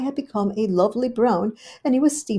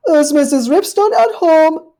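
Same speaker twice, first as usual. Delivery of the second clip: high, loud genteel caller; a polite little dog at the door; Duchess's voice; 10 semitones higher, 14 dB louder than usual. Acted. Duchess calling at Rivy's porch, high and genteel.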